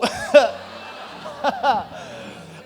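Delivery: long airy laugh; real breathy laughter. Real, not acted. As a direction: let a breathy laugh roll out. Laughs after the punchline.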